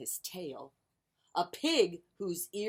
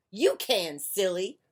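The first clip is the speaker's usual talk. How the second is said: grumpy; 2 semitones higher, 6 dB louder than usual. Acted. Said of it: "grumped Duck".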